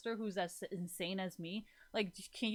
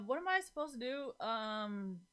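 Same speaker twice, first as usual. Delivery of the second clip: usual speaking voice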